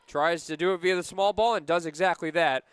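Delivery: normal talking voice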